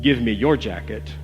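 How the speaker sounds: plain reading voice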